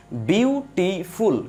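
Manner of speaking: "Beautiful" slowly in three separate beats; syllable by syllable. Shown as a demonstration. Teacher showing students a word, syllable by syllable. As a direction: break "beautiful" into বিউ, টি, ফুল. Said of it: "কয়টা স্টেপে এটা উচ্চারণ করতে পারছি".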